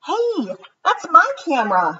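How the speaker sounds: lightly voiced boy, mildly indignant; a hint of a cross little boy. Martin tells Ruthie the camera is his, mildly indignant. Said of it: "Martin said".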